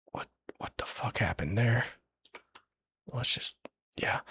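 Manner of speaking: real hushed, baffled whisper; whispered aside. Real, not acted. Storyteller puzzles over a mishap, whispering to self. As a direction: whisper to yourself in confusion.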